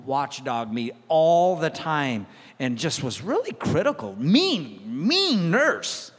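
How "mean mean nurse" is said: mock sneer. Mocking the mean nurse.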